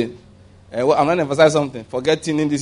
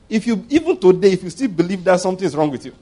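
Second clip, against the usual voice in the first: ordinary talk, nothing marked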